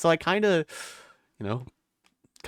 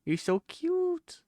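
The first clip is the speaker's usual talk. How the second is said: cooing over cuteness; 6 dB quieter, much slower than usual. Real, not acted. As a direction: melt over something adorable, high and soft. Gushing over a cute character.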